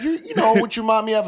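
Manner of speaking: high fast teasing voice; mock stranger voice. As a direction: play the nosy stranger lightly. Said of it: "they were like".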